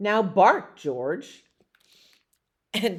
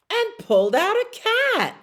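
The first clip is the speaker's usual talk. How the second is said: much higher and louder, the big reveal; surprised reveal; 7 dB louder than usual. A narrator's reading. Reading to children, revealing the cat with glee. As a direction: make the cat a delightful shock.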